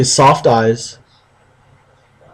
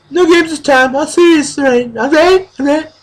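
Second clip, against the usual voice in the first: much higher, pleading little voice; high girly voice; 13 semitones higher than usual. Acted. Heroine insisting to James, voiced high. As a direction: plead sweetly in a high girl voice.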